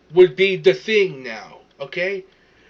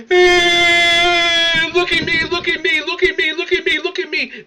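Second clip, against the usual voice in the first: high, loud fake wail for attention; hammed-up crybaby begging to be seen; mock crying; 9 semitones higher, 5 dB louder than usual. Mocks women filming themselves crying.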